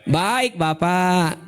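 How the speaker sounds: very slow, syrupy "Baik, Bapak"; sweet yes. Retold or imitated. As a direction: drawl it out sugary sweet. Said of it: "manis nggak? Manis banget".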